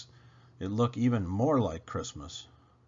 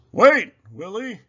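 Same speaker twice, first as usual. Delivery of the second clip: higher, louder breathless call; 9 semitones higher, 12 dB louder than usual. A narrator's reading. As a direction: shout after him from below. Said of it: "Santa leaves his belt".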